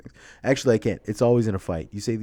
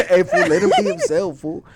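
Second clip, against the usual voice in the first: shouted, higher and louder, mock street fight call; 7 semitones higher, 8 dB louder than usual. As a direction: yell it like starting a brawl. Repeating the phrase in character.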